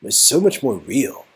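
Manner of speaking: earnest young man in awe, lightly voiced; a man lost in wonder before a painting; [name] admiring painting.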